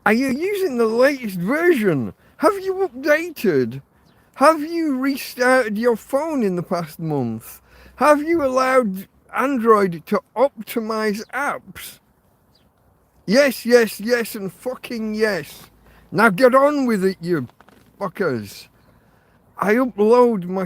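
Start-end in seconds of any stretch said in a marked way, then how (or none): 0.1-12.0 mocking support script. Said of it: "all they do is come back with the same little trite". 13.2-18.7 exasperated shout. Real, not acted. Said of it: "I'm a little bit shouty".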